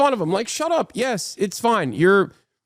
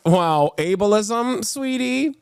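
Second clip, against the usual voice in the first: mocking condescension; 5 semitones higher, 4 dB louder, much slower than usual. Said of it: "trying to be like".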